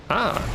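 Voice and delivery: sharp high startled yelp; short surprised cry. Real, not acted. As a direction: jump at a sudden foe. Sudden enemy appears.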